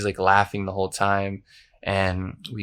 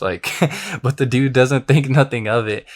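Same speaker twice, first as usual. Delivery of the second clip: normal talking voice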